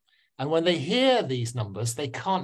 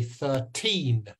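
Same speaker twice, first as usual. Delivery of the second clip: put-on thirteen with a drawn-out, falling TEEN; thir-TEEN, teacher's clear demonstration; stress on second syllable. Shown as a demonstration. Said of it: "which syllable am I stressing".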